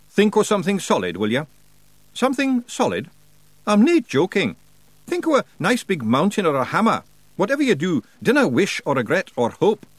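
high, forceful Scottish character voice; brisk Scots warrior coaching a newcomer; Rob Anybody, urgent Scots. Acted. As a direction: pitch it up, thick Scots, insistent.

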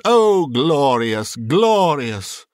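slow, drawn-out exclamation of bliss; rapturous. Acted. Scrooge breathes Christmas air at the window, enraptured. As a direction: savour each glorious with delight.

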